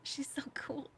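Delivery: softly whispered envy, fading to breath; a jealous little aside, whispered; envious whisper. Retold or imitated. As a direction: whisper it like a secret admiration. Quoted envious inner thought.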